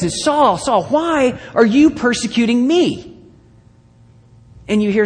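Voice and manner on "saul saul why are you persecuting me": high, slow, ringing call of God. Acted. Preacher voicing God calling out to Saul, slow and high. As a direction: call his name from heaven, slowly and high.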